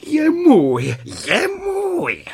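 cat triumphantly crows over the rabbit. Acted. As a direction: crow over the catch in triumph. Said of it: "vítězně s ním mává".

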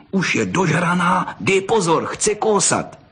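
put-on high-pitched excited boy's alert; urgent warning. A narrator's reading. Boy warns friend the fox will bite, urgent. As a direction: warn a friend, pitch climbing.

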